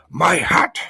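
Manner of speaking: loud, high outraged humbug; shout. A narrator's reading. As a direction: cry out indignantly. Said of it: "shouted the bug".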